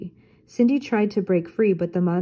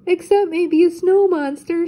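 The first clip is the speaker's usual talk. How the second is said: high, loud, frantic shriek; panicked yell; 10 semitones higher, 6 dB louder than usual. Acted. Shaggy yells to friends as the monster appears, loud and high. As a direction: yelp it out in sudden fright. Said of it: "Shaggy yelled".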